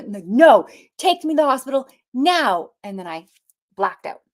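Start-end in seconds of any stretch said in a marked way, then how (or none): 0.3-2.7 high, loud insistent plea; urgent demand. Retold or imitated. To the father of her kid, loud urgent demand.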